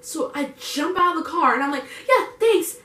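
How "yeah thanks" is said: high, loud quick-exit thanks; overeager thanks. Retold or imitated. Passenger jumping out of the car, overeager thanks.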